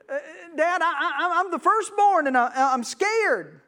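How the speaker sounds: timid frightened son; scared little boy. Acted. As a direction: stammer it out like a frightened kid. Hebrew firstborn tells dad he's scared.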